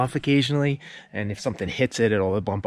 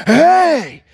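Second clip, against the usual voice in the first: sudden sharp shout, much higher and louder; yell; 13 dB louder than usual. Retold or imitated. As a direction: shout a warning across the yard at the son. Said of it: "That's why I yelled".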